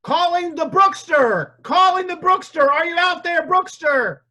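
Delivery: high, loud calling out; hollering for someone out there; calling from far away. Acted. Calling out for the guest.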